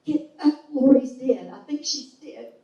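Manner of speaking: high, loud, frantic plea for help; desperate high-pitched alarm; retold panicked cry. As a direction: call out to him in a panic, reliving it. Panicked call for husband's help.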